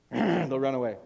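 ordinary talk

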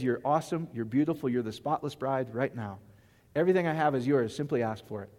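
whisper-close affection; tender voice of God. Acted. God praises his spotless bride, soft and tender.

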